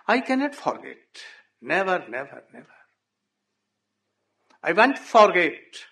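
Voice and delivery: cling to his love, pleading; grieving insistence. Acted. Nanda Baba vowing he'll never forget Krishna, pleading.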